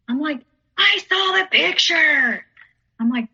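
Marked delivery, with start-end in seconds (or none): 0.8-2.5 high, stretched-out accusing yell; retold angry scream. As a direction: yell each word out slowly. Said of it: "screaming at me".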